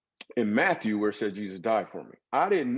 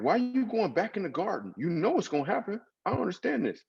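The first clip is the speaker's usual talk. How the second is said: much higher, baffled pleading at the Bible story; bewildered disbelief; 11 semitones higher than usual. Retold or imitated. Reading the Gospels, baffled that Jesus goes back to the garden. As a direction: voice climbing high in mock confusion. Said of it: "I'm like".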